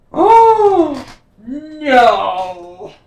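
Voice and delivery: shocked gasp. Real, not acted. Card reveal shock.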